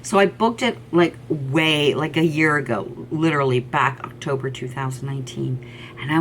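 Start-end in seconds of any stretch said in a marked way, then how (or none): none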